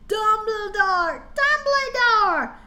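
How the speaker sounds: slow, high calling yell. Acted. Scared students calling out for Dumbledore, slow high yell. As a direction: call out for Dumbledore up high and drawn out. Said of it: "we both yelled".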